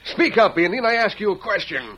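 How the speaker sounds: loud, high, bullying shout; barked order. Acted. Sheriff demanding Tonto answer, loud high shout. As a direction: bark at him to answer.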